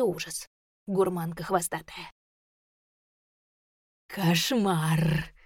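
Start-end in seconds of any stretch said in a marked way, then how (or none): 4.1-5.3 pleased growl. A narrator's reading. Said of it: "довольно рыкнула тень".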